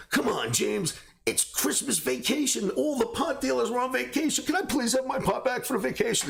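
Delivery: cheeky whining plea to the cop; mock pleading. Teen begs the cop to give his pot back, high mock whine.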